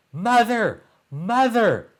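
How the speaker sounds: put-on a boy calling his mother for help; crying out. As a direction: shout for mother in a panic. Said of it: "cried Jack".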